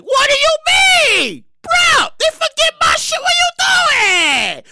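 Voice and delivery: very high, loud, stretched-out mock screaming; outraged borrowers. Borrowers whose relief got blocked confront the judge, mock-outraged screaming. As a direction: wail in hammed-up disbelief.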